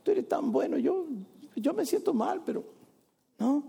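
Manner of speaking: high, sugary fake-pious plea; mock a hypocrite's sweet prayer; hypocritical prayer. Preacher mocking a hypocritical believer's prayer, sugary and high. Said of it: "te acercas a Dios con hipocresía".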